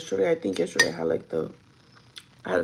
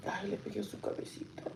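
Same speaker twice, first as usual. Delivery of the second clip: guilty whisper; 12 dB quieter than usual. Real, not acted. Guilty about the pet's head.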